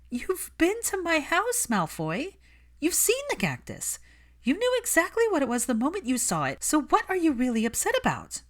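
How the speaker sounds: heated confrontation; furious. Acted. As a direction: corner him with the facts. Hermione confronting Malfoy furiously.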